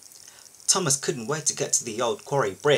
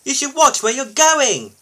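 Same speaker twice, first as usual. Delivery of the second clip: loud, high-pitched telling-off; cross lorry's shrill complaint; snapping; 12 semitones higher, 7 dB louder than usual. A narrator's reading. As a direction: scold indignantly at full voice. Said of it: "snapped a lorry".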